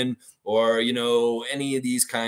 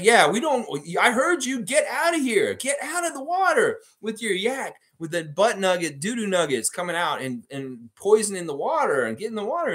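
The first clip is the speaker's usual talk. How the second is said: really mad; 9 semitones higher than usual. Retold or imitated. Lifeguard ordering yak owners out of lake, furious shouting. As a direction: chase them out of the water, furious. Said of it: "so he's really mad".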